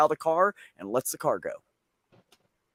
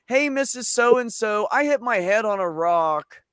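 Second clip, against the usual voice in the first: higher, slow, unbothered little voice; perfectly calm toddler; 6 semitones higher, slower than usual. Retold or imitated. Bleeding toddler calmly tells the neighbour, slow and higher. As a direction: report a bleeding head as if it's nothing. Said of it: "Perfectly calm".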